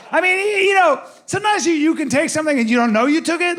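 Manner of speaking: put-on high, stammering kid excuse; backpedaling young son. Caught son explains himself to dad, high stammering voice. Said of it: "he goes, he goes".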